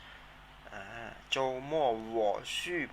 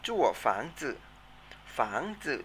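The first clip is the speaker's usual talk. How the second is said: อีจั่วฝังจื่อ said slowly, each syllable drawn out; slow model of อีจั่วฝังจื่อ for repeating; teacher pacing อีจั่วฝังจื่อ slowly; model pronunciation; slower than usual. Shown as a demonstration. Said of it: "ออกเสียงตามนะครับ".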